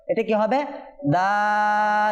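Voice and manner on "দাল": দাল sung out long and steady; drawn-out Dal. Shown as a demonstration. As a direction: hold the vowel of দাল for the class. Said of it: "আপনারাও আমাদের সাথে বলুন". (দাল টেনে পড়া)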